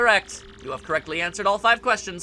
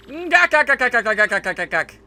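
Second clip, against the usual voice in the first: high gak gak cackle; villain cackle; 3 semitones higher, 4 dB louder than usual. Acted. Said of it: "sounds like Popeye when he laughs".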